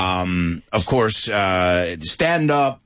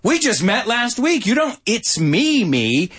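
high, drawn-out retold indignation; hammed-up complaint, pitched up with stretched words; mock upset; 8 semitones higher, slower than usual. Seinfeld upset about the new girlfriend, high mock indignation. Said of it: "And then he got upset".

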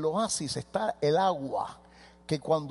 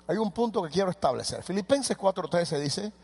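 usual speaking voice

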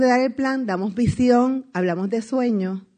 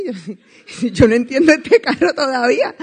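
louder, laughing as she speaks; laughing through it; 3 semitones higher, 6 dB louder than usual. Retold or imitated. Laughing to the audience about her stalled new car. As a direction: giggle helplessly at the stalled car.

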